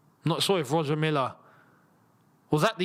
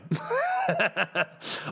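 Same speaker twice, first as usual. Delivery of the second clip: sudden high burst of laughter. Real, not acted. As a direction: crack up at the memory. Laughing at Pro Evo memory.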